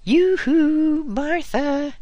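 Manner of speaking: high, loud sing-song hail across the garden; high, loud cheery call. A narrator's reading. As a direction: sing out a cheery yoo-hoo. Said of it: "she called".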